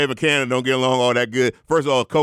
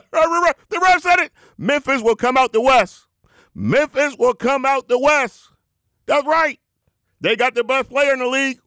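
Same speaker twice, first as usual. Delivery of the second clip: hyped preacher; 11 semitones higher, 3 dB louder than usual. Acted. The Rev declares Memphis the West winner, high hyped preaching. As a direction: proclaim the prediction like gospel, high. Preacher proclaiming his bold prediction.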